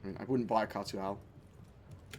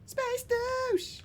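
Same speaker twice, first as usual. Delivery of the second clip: mock high squeal. Repeating the taunt.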